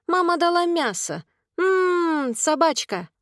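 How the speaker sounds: put-on high-pitched toddler chatter; very high, childish and pleased; little boy. A narrator's reading. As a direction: tell on mummy in a tiny child's voice. Toddler tells on mother.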